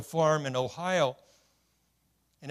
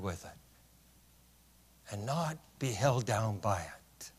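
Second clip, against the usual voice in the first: whispered; 5 semitones lower, 7 dB quieter than usual. Real, not acted. Preacher drops to whisper.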